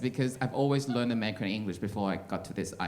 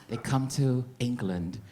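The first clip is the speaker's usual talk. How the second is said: ordinary talk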